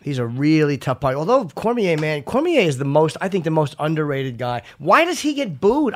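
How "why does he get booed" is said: much higher, slow indignant question; indignant question about the booing, pitched way up. Real, not acted. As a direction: demand an answer, outraged.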